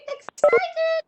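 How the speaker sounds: excited. Shown as a demonstration. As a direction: burst out with it. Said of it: "How do you feel excited?".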